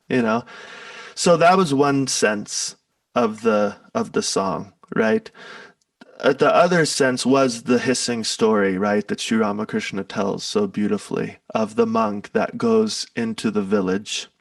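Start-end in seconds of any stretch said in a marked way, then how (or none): none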